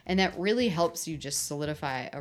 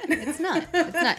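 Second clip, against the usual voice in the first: laughing; 12 semitones higher, 6 dB louder than usual. Real, not acted. Echoing with a laugh.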